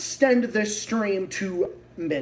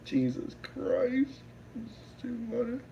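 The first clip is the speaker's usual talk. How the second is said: softer, spent from laughing; winded whisper after laughter; breathless after laughing; 4 semitones higher, 8 dB quieter than usual. Real, not acted.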